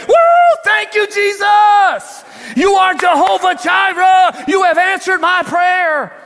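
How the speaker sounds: high, loud drawn-out whoop of thanks; ecstatic praise. Acted. Praying woman finds groceries on her porch, ecstatic praise. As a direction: shout thanks to heaven in jubilation.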